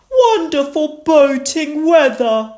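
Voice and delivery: hearty seafarer call, pitched high; Grandpa Pig. A narrator's reading. Grandpa Pig greets the family from the boat, loud and high. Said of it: "Grandpa Pig said".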